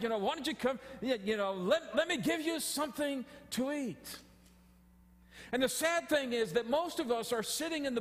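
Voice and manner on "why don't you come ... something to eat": high, coaxing vendor call; stadium vendor hawking. Retold or imitated. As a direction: lure the crowd in with food. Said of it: "They're calling out".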